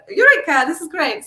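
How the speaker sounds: a light bulb just clicked on; eureka moment. Retold or imitated. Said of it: "It's like".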